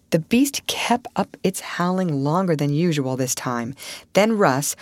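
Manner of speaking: usual speaking voice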